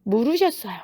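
ordinary delivery

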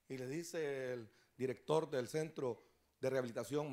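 ordinary talk, nothing marked

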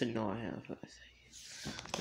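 soft low whisper. Pretend. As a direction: hush it so he won't wake. Creepy sleeping Mickey.

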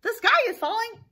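high, loud alarm cry; hammed-up panic. Chicken Little thinks the sky is falling, panicked cry. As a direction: raise the alarm like a frantic cartoon chick.

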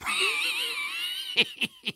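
long, very high, unhinged cackle; maniacal laugh. Acted. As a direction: laugh like a madman gone off the rails. After the voice lines.

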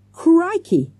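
high, loud cry of surprise; sudden high yelp of amazement; shouted exclamation. A narrator's reading. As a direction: shout it out, startled. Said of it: "shouted Morgan".

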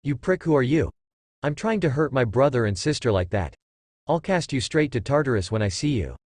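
plain reading voice

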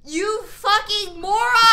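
slow, loud furious insult; furious yell. Real, not acted. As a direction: yell at the teammates in disbelief. Furious at teammates' vote.

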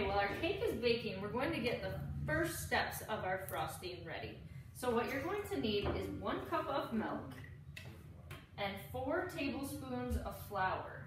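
ordinary talk, nothing marked

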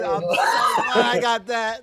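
high, loud laughing shout. Real, not acted. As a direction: yell it through laughter. Excited after funny clip.

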